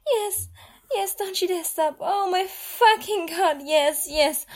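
high, loud breathy cries of pleasure; high ecstatic cries. Acted. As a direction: cry out, lost in the moment. Intimate scene, loud pleasure cries.

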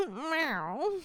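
a whimpering meow; crying meow that rises at the end; flustered meow. Acted. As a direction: meow with a startled little cry. Catgirl startled by intimacy.

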